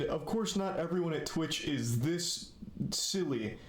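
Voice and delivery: normal talking voice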